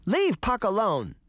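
put-on high-pitched girl's voice; defiant little girl. A narrator's reading. Daphne defending Puck from the wolf, defiant. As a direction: stand up for your friend.

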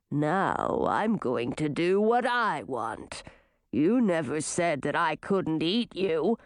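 low, slow monster voice; menacing manticore. A narrator's reading. Manticore threatens to eat the girl, low menace. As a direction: savour the threat, deep and unhurried.

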